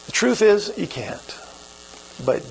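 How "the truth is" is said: usual speaking voice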